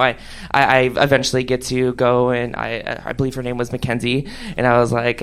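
ordinary delivery

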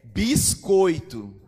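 long, loud, stretched word; drawn-out reveal. Acted. Neighbor tells the atheist the divine word, drawn out and loud. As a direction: drag it out for the punchline. Neighbor delivers God's odd message.

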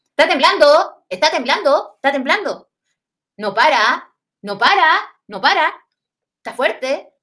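más fuerte y agudo, insistente; repítelo con urgencia, como quien avisa; abuela afirmando alarmada. Retold or imitated. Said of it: "Afirmación". (abuela avisa a la familia que tiembla, con alarma)

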